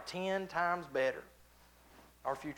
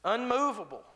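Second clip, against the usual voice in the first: higher and louder, ringing "Unmovable"; emphatic cry; 8 semitones higher, 5 dB louder than usual. Acted. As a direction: throw the word out to the room. Preacher's emphatic exclamation.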